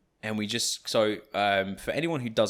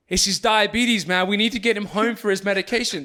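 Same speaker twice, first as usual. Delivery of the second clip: higher, louder urgent insistence; 10 semitones higher, 5 dB louder than usual. Retold or imitated. Friends covering for their mate to the bouncers, in a higher, louder urgent voice. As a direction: sell the lie with alarm. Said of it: "we go".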